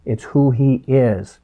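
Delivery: usual speaking voice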